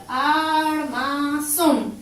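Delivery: "Armazón" very slowly as AR, MA, ZÓN; each syllable of "Armazón" drawn out, landing on ZÓN; split into syllables. Shown as a demonstration. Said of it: "Separamos en sílaba". (armazón separado en sílabas, muy lento)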